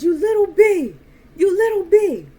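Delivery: high, loud outburst; shouted high and hard, acted out; retold angry yell. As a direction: imitate someone yelling an insult. Retold angry outburst at mocking child.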